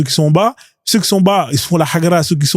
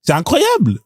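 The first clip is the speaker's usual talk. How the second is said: high-pitched incredulous reaction; high voice, can't believe it; amused disbelief; 6 semitones higher, slower than usual. Real, not acted. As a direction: marvel in a raised, drawn voice. Amused disbelief at played clip.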